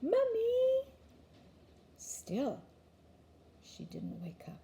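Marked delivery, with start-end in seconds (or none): 0.0-0.9 much higher, firmer call; child's second try, pitched up high; a little louder. A narrator's reading. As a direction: call more insistently to wake her. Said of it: "Luke said it a little louder".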